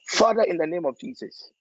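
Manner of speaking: impassioned pleading prayer, pitched high and rising; fervent prayer. Acted. Pastor praying for a sick boy's family, impassioned pleading. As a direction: cry out to God for mercy, building in intensity.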